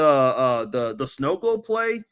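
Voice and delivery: ordinary delivery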